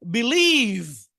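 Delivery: বিলিভ said very slowly with a long "ee"; teacher's model of বিলিভ, second vowel held long; drawn-out vowel. Shown as a demonstration. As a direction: stretch out the "lee" of বিলিভ. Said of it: "দুইটা অক্ষর থাকলে টান হয়".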